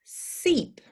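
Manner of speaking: Seep whispered with a long ee. Shown as a demonstration. Teacher modelling seep against sip. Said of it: "You say it".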